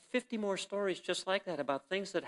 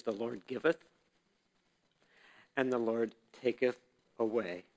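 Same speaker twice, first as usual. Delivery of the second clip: solemn scripture; 5 semitones lower, 4 dB quieter than usual. Acted.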